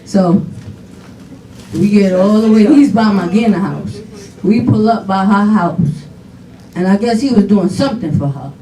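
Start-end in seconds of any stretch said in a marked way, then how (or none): none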